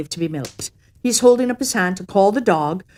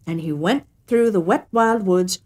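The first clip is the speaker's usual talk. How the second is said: ordinary delivery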